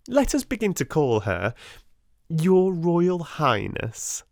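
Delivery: slow, high mock-grand title; mocking Lavinia. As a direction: drawl the title to ridicule her.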